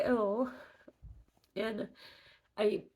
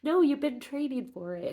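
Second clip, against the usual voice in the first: higher, louder firm insistence; insistent sister; 5 semitones higher, 5 dB louder than usual. Retold or imitated. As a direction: push back like a stubborn big sister. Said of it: "she was still kind of like".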